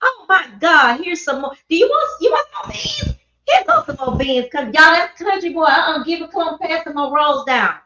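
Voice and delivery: put-on high fussing old-lady voice; doting church ladies. Church ladies fussing over the country boy, high and doting. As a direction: coo and fuss like doting little old ladies. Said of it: "and they gonna".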